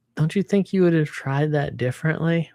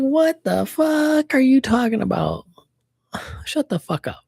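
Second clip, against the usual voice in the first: much higher, outraged disbelief; indignant outburst; 10 semitones higher than usual. Retold or imitated. Defending herself against the customer's insult, outraged. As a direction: snap back in shock, then a sighing dismissal. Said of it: "I was like".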